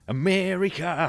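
put-on drawn-out, high, slow American-accented America; mock American accent. Podcast host mocking the American legal scene, slow mock American accent. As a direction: say America the American way, stretched and lifted.

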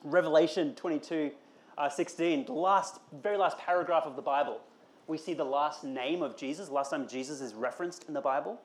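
ordinary talk

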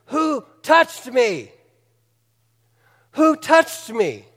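slow, high, searching call of who touched me; Jesus slowly calls out to the crowd; Jesus asking. Acted. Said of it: "He says".

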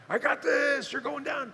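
a hint of a competitor's trash talk; cocky sperm. Pastor voicing a racing sperm, lightly cocky.

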